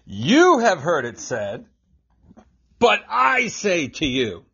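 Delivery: slow, emphatic YOU, then I punched high; measured proclamation leaning hard on "I"; quoting Jesus preaching. Acted. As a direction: set "You" against a lifted "I", slowly. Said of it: "Think of the Sermon on the Mount".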